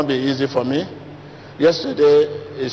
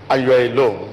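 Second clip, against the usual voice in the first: ordinary talk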